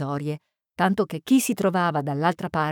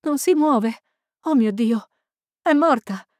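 higher-pitched, broken cries of alarm; alarmed distress; 4 semitones higher than usual. Acted. Young librarian reports the lifeless body, alarmed. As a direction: cry out in short, shocked bursts.

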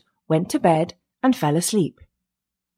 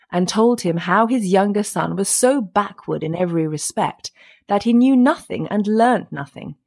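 ordinary delivery, nothing marked

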